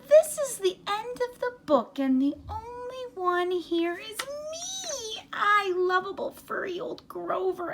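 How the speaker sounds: high, excitable furry monster pleading and boasting; Grover voice. Acted. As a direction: play Grover, scared and then proud. Reads Grover's lines in character.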